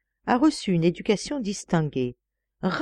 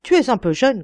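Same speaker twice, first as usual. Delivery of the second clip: angry; 5 semitones higher, 5 dB louder than usual. Acted. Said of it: "il se fâcha".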